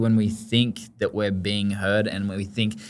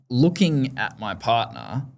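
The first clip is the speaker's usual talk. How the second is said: ordinary talk, nothing marked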